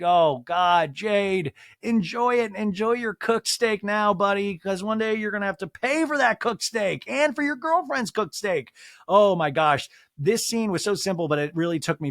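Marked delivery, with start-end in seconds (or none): 0.0-8.7 high teasing big-sibling advice; teasing, higher and excited. Retold or imitated. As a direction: rib him with a raised voice.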